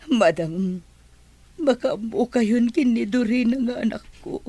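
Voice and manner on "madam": ordinary delivery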